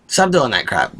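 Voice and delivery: normal talking voice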